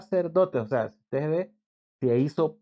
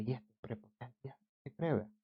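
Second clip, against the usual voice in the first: hushed, much softer grumble; bystanders muttering; 13 dB quieter than usual. Retold or imitated. People in line grumble quietly about the arrogant man, hushed. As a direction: murmur it under your breath.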